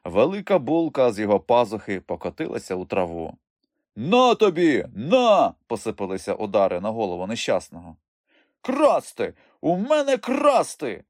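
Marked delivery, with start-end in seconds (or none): 4.0-5.5 loud, high, punched words; violent outburst. A narrator's reading. Traders beating the fallen thief, furious outburst. 8.6-11.0 high, indignant cry; outraged yelling. A narrator's reading. Robbed young trader yelling at the thief, outraged. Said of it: "найбільше репетував молодик".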